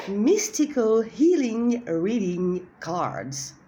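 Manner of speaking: slow, self-mocking French-accented English; a slow French accent on the English title. Real, not acted. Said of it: "avec mon accent yougoslavo-portugais".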